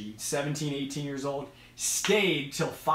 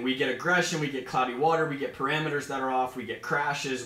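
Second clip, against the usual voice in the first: ordinary talk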